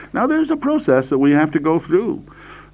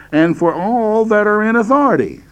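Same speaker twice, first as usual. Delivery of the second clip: "all" raised high and stretched out; stress on all; 8 semitones higher than usual. Shown as a demonstration. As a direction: read it out, lifting "all" high. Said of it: "I'm emphasizing the word all here".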